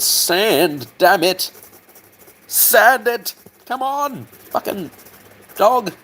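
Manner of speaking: high strained put-on exasperation; mock scolding. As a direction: berate it in a high cartoonish voice. Mock-scolding the sanding job.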